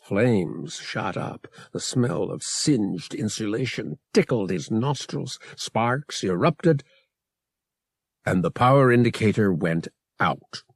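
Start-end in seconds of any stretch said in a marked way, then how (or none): none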